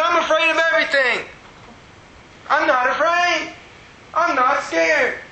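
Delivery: high, insistent excited cry; retold happy bawling. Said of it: "I'm still bawling".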